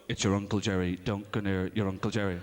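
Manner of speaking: deep, mock-serious mum warning; low warning from mum at the door; deep mock mother's caution. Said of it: "Mum would go".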